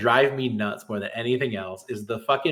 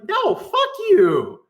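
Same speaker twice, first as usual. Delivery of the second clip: much higher, slow, shouted refusal; furious shout; 5 dB louder, much slower than usual. Real, not acted. Shouting at a troll who begged to be unbanned, slow shout. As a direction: yell it right in his face.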